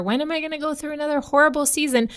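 mock eagerness. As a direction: rattle it off with fake glee.

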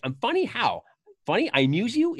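high, mock-threatening question; menacing Tommy. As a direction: push the words back at him. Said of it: "Tommy's like".

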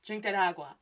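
usual speaking voice

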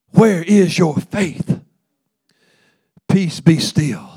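loud, high, driving preacher's voice; full-force gospel preaching; fiery preaching. Acted. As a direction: preach it hard to the congregation.

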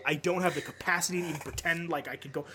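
usual speaking voice

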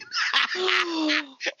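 shrieking laughter. Real, not acted. Cracking up at joke.

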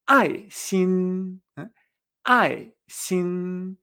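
ai xin, fourth then first tone; falling then level. Shown as a demonstration. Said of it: "La palabra amor".

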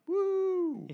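high cheering woo; excited whoop. Real, not acted. Cheering at Groot's win.

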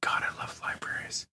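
breathy whispered adoration. Real, not acted. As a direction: whisper it with love. Swooning over libraries.

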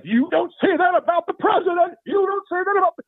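high-pitched indignant scolding; really mad. Acted. Conservative grandfather scolding Rusty about the president, mad. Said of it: "he would get really mad".

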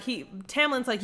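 ordinary talk

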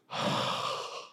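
long soft exhale; deflated sigh. Retold or imitated. Boyfriend reacting to a brutal breakup, deflated sigh. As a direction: let the air out in an acted crushed sigh. Acted reaction to being dumped.

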